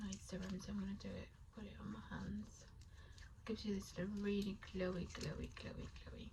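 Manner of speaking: soft ASMR-style whisper. Real, not acted. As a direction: breathe the words out, barely voiced.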